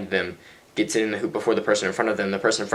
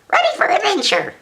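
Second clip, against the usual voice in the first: very high squeaky helium voice; tiny, sky-high cartoonish helium squeak; 3 dB louder than usual. Shown as a demonstration. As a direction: squeak it out like you've breathed helium. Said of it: "that's my helium voice".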